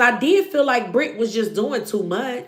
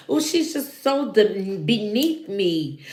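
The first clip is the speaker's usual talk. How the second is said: mock snooty; 4 semitones lower, 3 dB quieter than usual. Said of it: "you just so classy".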